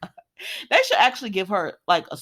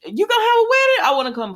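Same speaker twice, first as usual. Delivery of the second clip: put-on high, fast, louder child's plea; little girl voice; 6 dB louder, faster than usual. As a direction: chirp it like an excited little girl. Little Violet asking to come.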